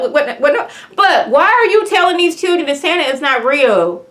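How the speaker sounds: high, loud, incredulous what what what; laughing disbelief. Real, not acted. Viewer outraged at the paramedic on screen, high disbelieving laugh. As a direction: squeal in shocked disbelief with a laugh.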